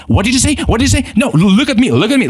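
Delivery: mock confrontational, high and challenging; mock indignant demand; imitating an angry person. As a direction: play the defensive one squaring up. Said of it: "they're just like".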